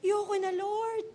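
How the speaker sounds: high, slow tearful plea; mock-tearful surrender; acted crying. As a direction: wail softly to God, worn out. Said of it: "Sumiyak ka na".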